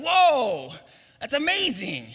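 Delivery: high, loud whoa of delight; mock delight. Preacher playing someone biting a donut, delighted. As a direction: gush over a surprise filling.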